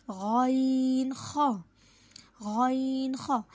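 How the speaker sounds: गॉइन ख very slow, rasped from the front of the throat; throat letter demo. Shown as a demonstration. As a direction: scrape गॉइन ख slowly at the top of the throat. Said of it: "अदना हलक से".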